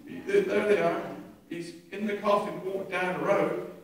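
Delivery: usual speaking voice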